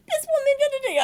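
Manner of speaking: high, loud mock rant; agitated complaint. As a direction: rattle off a worked-up grievance, pitched high. Retold ranting complaint to club wife.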